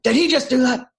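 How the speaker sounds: high, loud imitated disbelief; stunned coach. Coach on the bench seeing the trick shot, high loud mock disbelief. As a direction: squawk it like a coach who can't believe it. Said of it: "He's like".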